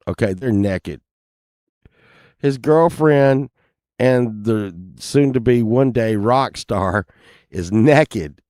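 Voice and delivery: ordinary talk, nothing marked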